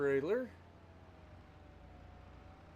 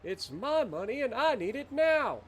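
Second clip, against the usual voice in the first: greedy customer; 8 semitones higher than usual. Pretend.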